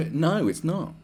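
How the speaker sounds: ordinary talk, nothing marked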